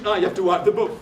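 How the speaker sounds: ordinary talk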